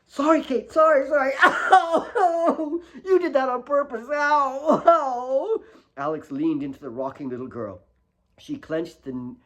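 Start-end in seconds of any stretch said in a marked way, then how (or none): 0.1-1.4 higher, loud sorry through laughter; laughing apology. A narrator's reading. Alex after tumbling onto Kate, laughing apology. 1.4-5.6 higher, loud, tearful cries; crying girl. A narrator's reading. Kate hurt on the road, crying at Alex. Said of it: "sat up crying".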